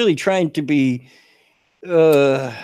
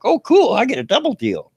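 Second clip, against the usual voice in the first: high, bright-eyed delight; thrilled at a double deal; excited; 9 semitones higher, 4 dB louder than usual. Retold or imitated. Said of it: "I'm like".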